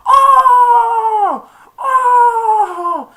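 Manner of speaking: long, very high wail; imitated agonised "oh" at full volume; mock howl of pain. Man kicked in the groin.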